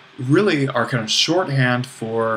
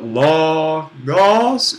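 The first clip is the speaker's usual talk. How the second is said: LOGOS very drawn out, climbing higher on each syllable; doubled rising pitch; 5 semitones higher, 6 dB louder than usual. Shown as a demonstration. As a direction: push "logos" up, then up again, slow and louder. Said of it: "double up".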